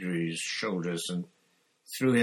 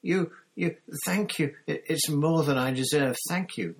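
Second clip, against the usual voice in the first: high, loud, stammering gratitude; gasping; 8 semitones higher, 6 dB louder than usual. A narrator's reading. Pettigrew thanks Harry for sparing him, gasping. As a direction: gush thanks in abject relief. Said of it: "gasped Pettigrew".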